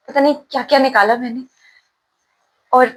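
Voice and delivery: normal talking voice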